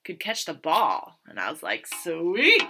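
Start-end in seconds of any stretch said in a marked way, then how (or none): 1.9-2.6 loud, playful cheer; retold delight. First baseman after a catch, loud retold delight. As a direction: belt it out as a mock celebration.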